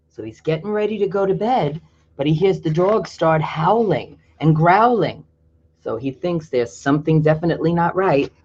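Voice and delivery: ordinary delivery, nothing marked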